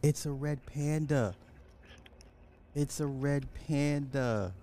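high, cooing voice over a cute animal; cooing over a panda. Pretend. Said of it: "Let's do a little A S M R".

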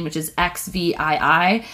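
normal talking voice

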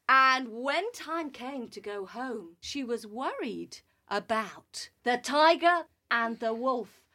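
plain reading voice

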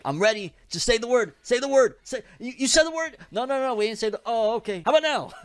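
high-pitched, excited reaper voice, mock menace; eager Grim Reaper. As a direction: play an overeager reaper begging for the word.

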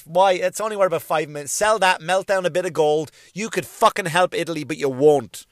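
usual speaking voice

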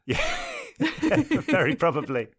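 laughing, slow and high. Real, not acted. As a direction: laugh through the words. Laughing at the subtitle joke.

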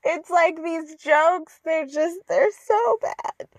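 high, slow half-sobbing whine; fake-weep over bad jokes; mock crying. Despairing at the show's jokes, mock tearful whine.

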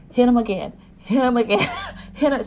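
root for her like a fan; excited cheering. Retold or imitated. Cheering on Cha Young's slapping.

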